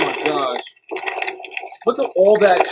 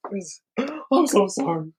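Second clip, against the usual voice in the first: whispered apology; 4 semitones lower, 6 dB quieter than usual. Real, not acted. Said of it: "That was disturbing".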